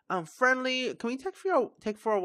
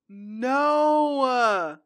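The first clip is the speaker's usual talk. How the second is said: crying; 4 dB louder than usual. Real, not acted. Grieving Sim's death.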